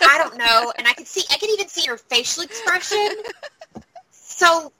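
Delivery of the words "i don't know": normal talking voice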